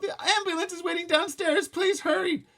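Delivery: high, loud pleading; wailing. A narrator's reading. Mother pleading with the elevator man. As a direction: beg him to hurry. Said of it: "Mom wailed".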